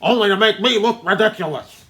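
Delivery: loud, high put-on rage with laughs; hammed-up furious producer. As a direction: rant like an insulted movie mogul. Acted movie rant, very loud.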